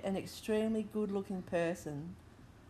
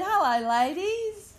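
put-on slow, smooth English accent greeting; 8 semitones higher, 3 dB louder, slower than usual. Retelling the hunky Englishman greeting them, English accent. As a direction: do the charming English guy, slowly. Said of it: "in his English accent".

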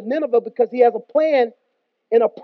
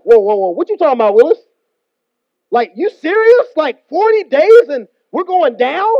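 mock panic; 3 semitones higher, 7 dB louder than usual. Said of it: "The city freaks out".

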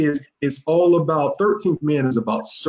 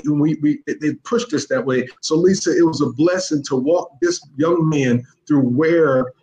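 ordinary talk

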